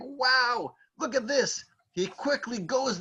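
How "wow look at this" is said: mildly acted wonder, higher voice; high, hushed mock amazement; amazed excitement. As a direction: marvel at a sudden find, pitched up. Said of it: "He's going crazy. He's like".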